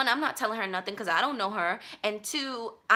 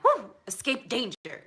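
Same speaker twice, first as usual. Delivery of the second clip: mock relief; 5 semitones higher, slower than usual. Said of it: "I'm like".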